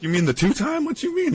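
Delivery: high, hammed-up outraged question; defending a two-time champ, high and indignant; mock indignation. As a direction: play offended, pitch shooting up.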